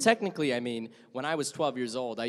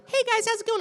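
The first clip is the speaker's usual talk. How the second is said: put-on much higher, squeaky young gamer; high kid voice; 6 dB louder, faster than usual. Shown as a demonstration. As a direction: chirp it like a twelve-year-old. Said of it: "instead of me being like".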